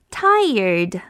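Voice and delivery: usual speaking voice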